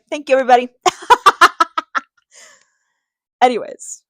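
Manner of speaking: thanks dissolving into a loud laugh; embarrassed loud laughter. Real, not acted. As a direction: laugh it off after the mix-up. Laughing at own mix-up.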